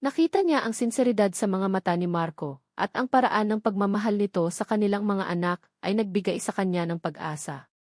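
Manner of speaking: usual speaking voice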